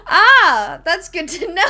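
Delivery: loud, high, breaking into real laughter; loud exclamation tumbling into a laugh; laughing reaction. Real, not acted. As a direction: crack up while answering. Cracks up at a chat message.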